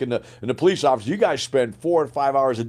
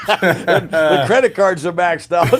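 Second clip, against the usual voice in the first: louder, slower, through real laughter; laughing; 6 semitones higher, 8 dB louder, slower than usual. Real, not acted. Telling the host about funding the company, laughing. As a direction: laugh your way through the words. Laughing while recalling money trouble.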